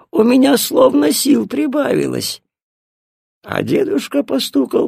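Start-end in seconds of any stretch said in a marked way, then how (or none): 0.1-2.4 high-pitched granny voice; an old woman feeling her strength return; old grandmother. A narrator's reading. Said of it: "сказала бабушка". (высокий старушечий голос)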